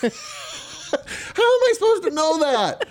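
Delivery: high, laughing almost crying. Real, not acted. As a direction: squeak it out through laughter. Laughing at spouse's logic.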